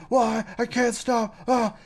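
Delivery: cringey overacted freak-out, voice jumping up; mock freak-out. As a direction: imitate an overwrought actor losing it. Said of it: "he has this, like, freak out".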